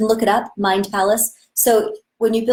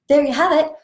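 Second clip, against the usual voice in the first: higher, louder cheerful repeat; excited; 7 semitones higher, 5 dB louder than usual. Shown as a demonstration. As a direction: say it again with a lift. Repeats line with energy.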